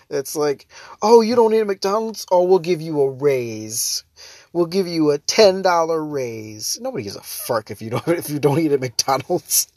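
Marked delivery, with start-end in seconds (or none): 1.0-6.8 slow, sneering mock praise; sarcastic mock. Mocking the snobs with a fake raise, slow and sneering. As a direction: drag it out like a fake reward.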